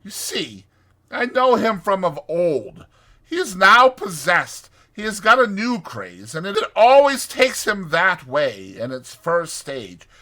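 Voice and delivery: high, emphatic know-it-all Rat; firm. A narrator's reading. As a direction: explain it loudly, brooking no argument. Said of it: "replied the Rat firmly".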